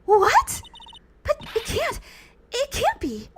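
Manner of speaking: high, breathless disbelief; high girl voice refusing the truth; shocked girl voice. Acted. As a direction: deny it in a high shaken voice. Voicing a shocked character.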